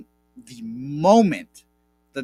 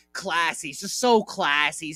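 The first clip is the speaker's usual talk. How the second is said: ordinary talk, nothing marked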